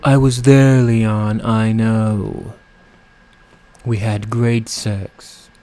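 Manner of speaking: slow, sultry drag queen purr; campy drag queen. Retold or imitated. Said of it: "I sounded like a drag queen with my impersonations of".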